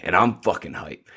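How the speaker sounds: ordinary talk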